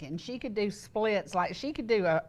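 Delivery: ordinary talk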